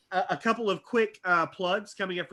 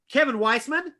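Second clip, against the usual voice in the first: raised, loud announcer call; big curtain-call introduction; announcer roll call; 8 semitones higher, 5 dB louder than usual. Real, not acted. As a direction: present the performer to applause. Host's roll call of the cast.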